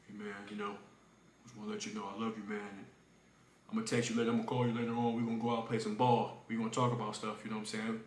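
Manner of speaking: low, soft imitation of a father; hushed dad apology. Dad leaving at dawn after a fight, imitated hushed apology. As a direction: murmur it low, like an awkward peace offering.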